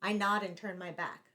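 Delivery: ordinary delivery, nothing marked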